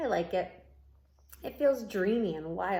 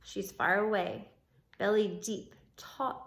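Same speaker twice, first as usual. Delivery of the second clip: plain reading voice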